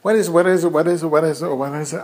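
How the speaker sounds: high-pitched nagging on a loop; pestering kid. Retold or imitated. Nephew repeating the question until answered, mock pestering. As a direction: mimic a kid who won't stop asking. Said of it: "asking the same question until he gets an answer".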